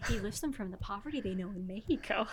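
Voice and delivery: a breathy whisper of the line; a mocking whisper of the quote. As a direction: whisper it under your breath. Repeating the quote to herself.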